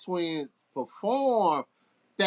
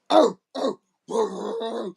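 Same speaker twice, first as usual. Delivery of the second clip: imitated barking; 3 semitones higher, 6 dB louder than usual. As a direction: act out a man barking like a dog. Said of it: "barking like".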